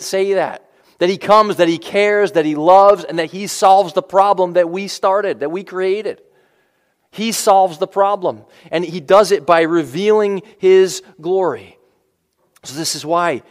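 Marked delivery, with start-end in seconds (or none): none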